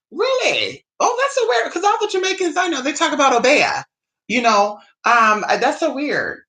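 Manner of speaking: high, sing-song fake surprise; sarcastic wide-eyed surprise; mock amazement. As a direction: feign shock to mock them.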